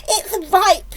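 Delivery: high, loud discovery; delighted cry. A narrator's reading. Aunt touches the peach, excited cry. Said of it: "she cried".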